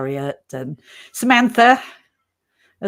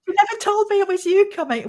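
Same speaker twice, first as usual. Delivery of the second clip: surprised; 13 semitones higher, 4 dB louder than usual. Retold or imitated. Quoting the surprised intern.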